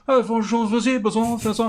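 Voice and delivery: high, hammed-up French accent greeting; mock French. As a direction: put on a fake French accent to fool the girls. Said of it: "go from this country".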